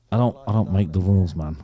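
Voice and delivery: put-on low Manchester accent. Second attempt at the singer's reply, low Manchester accent. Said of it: "I'll try again".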